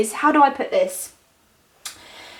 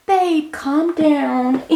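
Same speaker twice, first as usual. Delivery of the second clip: higher, very slow, hammed-up American accent; bad American accent; 5 semitones higher, much slower than usual. Hailey soothing angry Justin, in a mock American accent. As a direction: drawl it out in a clumsy fake American accent. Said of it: "World's worst American accent there".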